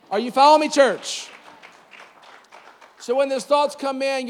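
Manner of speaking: high, loud call to the church; rousing shout. Real, not acted. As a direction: fire up the congregation.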